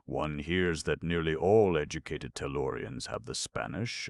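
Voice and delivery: ordinary delivery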